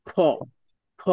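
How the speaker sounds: "ফ" with both lips together, then a puff of air; ঠোঁট জুড়ে বাতাস ছাড়া. Shown as a demonstration. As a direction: press the lips, release "ফ" with pressure. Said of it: "দুই ঠোঁট থেকে, ... হাওয়াটা জুড়ে". (দুই ঠোঁট জুড়ে বাতাস বের করে "ফ")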